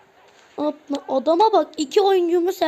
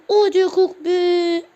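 louder, slow, crying plea; whiny plea; 4 semitones higher, 6 dB louder, slower than usual. Real, not acted. Gamer begs his fallen player to get up, crying plea. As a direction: beg it with a whimper.

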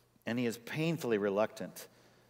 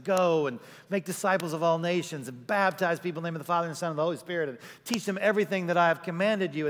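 voicing Jesus; 6 semitones higher, 4 dB louder, faster than usual. Jesus quoted, Great Commission.

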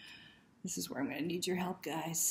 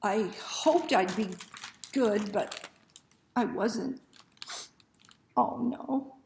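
anguished dismay; 5 semitones higher, 8 dB louder, slower than usual. A narrator's reading. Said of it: "George thinks".

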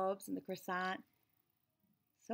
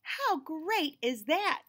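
slow, higher, gushing teacher voice; teacher's gushing praise; 7 semitones higher, 5 dB louder, much slower than usual. A narrator's reading. Teacher praising the child's drawing, slow and gushing. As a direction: stretch it out like an overexcited teacher. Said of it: "and she said".

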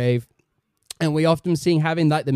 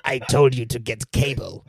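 low Emperor scold; 5 semitones lower than usual. Acted.

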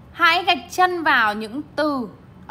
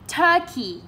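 turkey with TUR high, key falling; stress on first syllable; 5 semitones higher than usual. Shown as a demonstration. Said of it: "turkey cũng là trọng âm một luôn".

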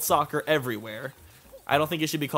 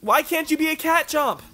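whiny yell at the game; 11 semitones higher, 4 dB louder than usual. Real, not acted. Yelling at game enemy.

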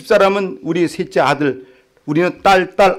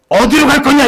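commanding exorcist; 11 semitones higher, 5 dB louder than usual. Retold or imitated. Deaconess commanding demon.

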